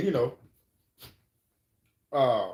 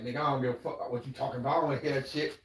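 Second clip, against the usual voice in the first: softer, fast brush-off; dismissive; 7 dB quieter, much faster than usual. Retold or imitated. Muddy turns away from Wolf, quick soft dismissal. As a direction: wave him off quickly.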